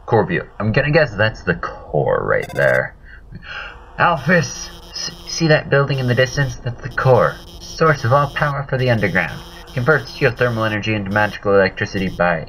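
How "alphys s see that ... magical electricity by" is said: higher-pitched, eager explainer voice; eager game character. Acted. Helper explaining the core to the player, eager high voice.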